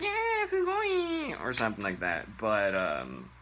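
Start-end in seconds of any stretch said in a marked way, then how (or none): 0.0-1.4 very high, slow squealed Yeah, sugoi!; squealing fangirl. Retold or imitated. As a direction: coo it like a giddy fan. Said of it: "will go like".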